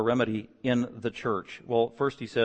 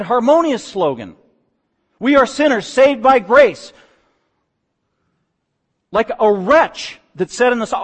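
louder, higher fervent sermon delivery; full-force preaching; 6 semitones higher, 8 dB louder than usual. Acted. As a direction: preach it out to the congregation with fire.

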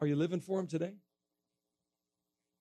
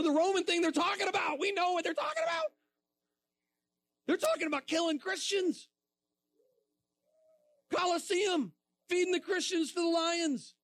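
impassioned preaching; 11 semitones higher than usual. Real, not acted. Preacher telling middle schoolers about the Colosseum, impassioned. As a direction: drive it home to the crowd.

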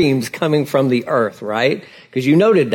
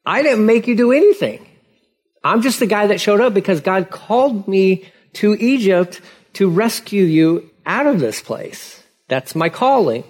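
higher, indignant Moses defending himself; exasperated Moses; 6 semitones higher than usual. Retold or imitated. Moses defending himself to the rebels, higher and indignant. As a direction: insist you only answered the call. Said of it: "He's like".

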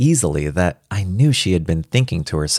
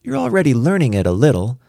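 put-on higher, teasing child's voice; impish little girl; 7 semitones higher, faster than usual. A narrator's reading. Daughter teasing her would-be pupil father, impish. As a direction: tease like a small daughter. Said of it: "She looked at me impishly".

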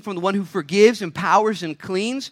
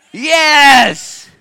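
one big high, loud yes; triumphant shout; 8 semitones higher, 12 dB louder than usual. Real, not acted. Preacher answering his own question with a loud, triumphant yes.

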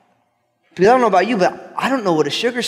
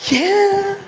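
very high, put-on squeal of agreement; mock delighted yeah; 14 semitones higher than usual. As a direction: play along in a silly high voice. Answers the Southerner in put-on voice.

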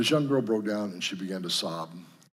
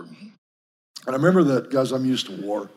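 usual speaking voice

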